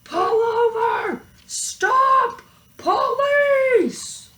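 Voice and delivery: very high, loud police shout; sheriff hollering. A narrator's reading. Sheriff yelling at the cows to pull over, very high and loud. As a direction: bellow it through the window. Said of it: "hollered out".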